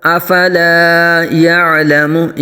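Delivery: slow melodic tajweed chant; Quran recitation. Shown as a demonstration.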